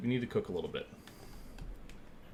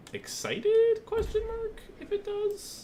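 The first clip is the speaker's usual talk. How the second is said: mock excited; 5 dB louder, slower than usual. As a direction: jump up into a squeaky voice much higher. Mock excited, reading out punctuation.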